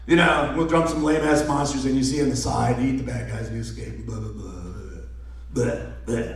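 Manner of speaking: low, fading, bored mumble; dismissive summary. Real, not acted.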